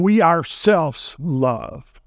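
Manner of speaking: very slow, every word weighed; slow emphatic "We ourselves love". Shown as a demonstration. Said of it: "in the Greek is emphatic".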